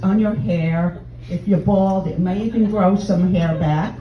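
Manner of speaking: normal talking voice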